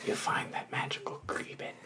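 hushed reverent whisper. Pretend. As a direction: whisper it like a magical secret. Dreamy reveal of the cookie.

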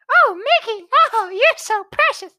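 very high squeaky cartoon girl mouse; Minnie Mouse voice. Imitating Minnie for a friend, very high squeak. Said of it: "Minnie has, like, a really high-pitched voice".

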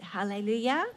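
normal talking voice

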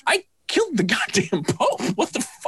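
high, incredulous mock outburst; acted disbelief. Gamer reacting to killing the Pope, mock disbelief. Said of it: "you're like".

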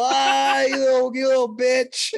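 high playful jeer; mock taunt. Taunting the table that drank it, high mock jeer. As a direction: rib them like a rowdy beer-hall mate. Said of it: "you go".

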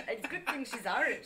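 mock Irish lilt on the line; hammed-up Irish accent, laughing. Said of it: "said it in an almost Irish accent".

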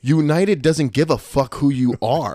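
slow, loud, emphatic telling-off; acted exasperated rebuke; retold scolding. Retold blunt rebuke to friend.

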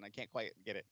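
ordinary talk, nothing marked

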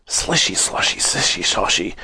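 hushed splashing slishy sloshy; sloshing water sounds. A narrator's reading. Narrator mimics the wash water pouring, softly whispered.

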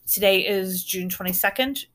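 ordinary talk

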